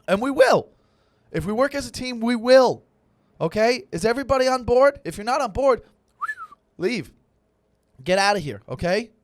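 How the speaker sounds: loud, high pumped-up coach yell; rally the team like a coach; coach pep talk. Pretend. A coach firing up his team with a loud rallying yell.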